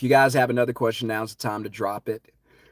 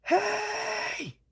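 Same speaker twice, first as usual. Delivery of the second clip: much higher calling shout; yelling down; 5 dB louder, much slower than usual. Retold or imitated. Warning people on the beach from a rooftop, yelling. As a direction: call down from a rooftop. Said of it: "I'm yelling".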